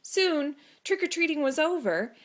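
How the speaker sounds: ordinary delivery, nothing marked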